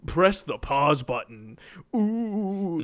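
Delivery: slow teasing line into a high ooh; playfully eerie, mocking a knowing wink; mock mysterious. Mocks self-aware meta games.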